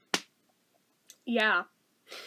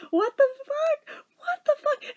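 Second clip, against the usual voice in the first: slow, high-pitched What the fuck; high incredulous cry; 10 semitones higher, slower than usual. Real, not acted. Seeing her redheaded twin, crying out in high disbelief. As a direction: squeal it in disbelief.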